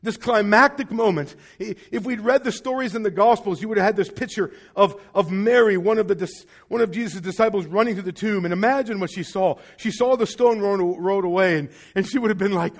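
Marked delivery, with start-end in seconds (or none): none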